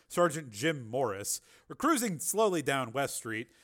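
usual speaking voice

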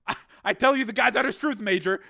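high, loud, rattled plea; flustered, urgent protest; pleading stammer. Acted. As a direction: plead your innocence, tripping at the start. Scientist pleads innocence.

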